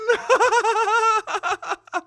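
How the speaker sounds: high, shouting laughter; excited laugh. Real, not acted. Celebrating the finish.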